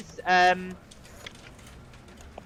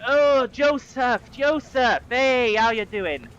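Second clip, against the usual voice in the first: cheery greeting; 6 semitones higher, 6 dB louder, much slower than usual. Acted. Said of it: "he's like".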